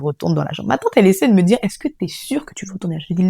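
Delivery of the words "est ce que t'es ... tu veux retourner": the aunt's worried question, whispered. Retold or imitated. Aunt asking grieving niece if she's sure, whispered. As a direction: ask it under your breath, carefully.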